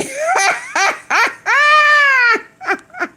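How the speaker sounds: helpless laughing fit; shrieking laugh. Real, not acted. Streamer laughing at chat usernames, high shrieking laughter.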